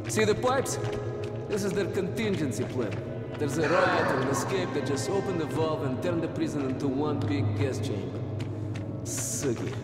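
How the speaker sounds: low quiet voice; hushed. Acted. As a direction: keep it down while sneaking. Sneaking through prison, speaking low.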